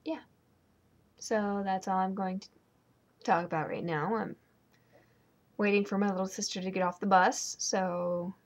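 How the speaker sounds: ordinary delivery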